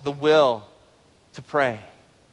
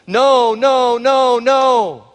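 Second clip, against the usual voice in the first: high, loud, anguished wail of no; a desperate mother crying out in anguish to Jesus; 9 semitones higher, 10 dB louder, much slower than usual. Acted. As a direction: cry out as if the worst is happening. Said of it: "wouldn't you pray like that".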